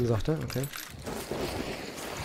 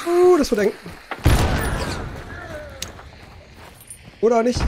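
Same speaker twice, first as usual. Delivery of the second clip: tense excitement; 12 semitones higher than usual. Real, not acted. Tight spot in the fight.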